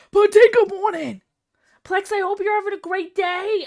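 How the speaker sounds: high, loud morning call; cheery greeting. Real, not acted. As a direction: shout a goofy hello.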